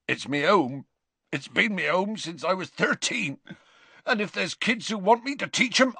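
rustic groundskeeper welling up, pitch climbing; Hagrid, rough-spoken and tearful. Acted. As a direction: plead loyally, faltering into grief.

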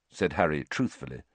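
plain reading voice

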